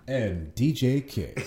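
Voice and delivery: loud, slow name drop; mock announcer. DJ guest adding his name to the plug, mock announcer. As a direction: boom it out like a sponsor tag.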